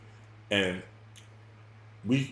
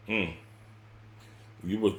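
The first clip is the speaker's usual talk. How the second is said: usual speaking voice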